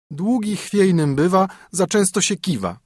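high, teasing sing-song rhyme; a haughty princess mocking a fat knight in verse; princess mocking a suitor. A narrator's reading. Princess sneering at a suitor, teasing and high.

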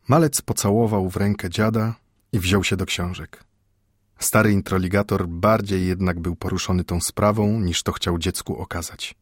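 plain reading voice

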